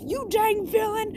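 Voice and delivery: high, mock-angry kid bully. Bully yelling at Deku, high mock-angry voice. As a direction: sneer it like a schoolyard bully.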